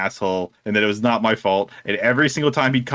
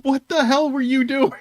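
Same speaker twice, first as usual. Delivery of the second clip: high, slow squeal of disbelief; incredulous manager; 13 semitones higher than usual. Retold or imitated. Manager asks employee about the incident, incredulous imitation. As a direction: draw out the question, pitched way up.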